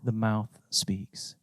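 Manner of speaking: soft, slow and low; hushed emphasis. Real, not acted. Preacher closing the verse to his congregation quietly. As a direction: let it land in a near whisper.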